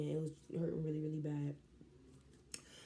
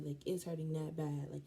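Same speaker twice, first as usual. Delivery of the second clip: usual speaking voice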